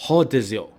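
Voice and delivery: Rodizio said with an H sound at the start, like hodizio; Brazilian pronunciation. Shown as a demonstration. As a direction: open Rodizio with a breathy H, Brazilian style. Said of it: "it is pronounced in Brazil with a H".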